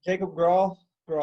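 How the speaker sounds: "Grahl" stated plainly as a correction; name pronunciation demo. Shown as a demonstration. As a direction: say "Grahl" clearly as one syllable. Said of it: "how do we pronounce your second name".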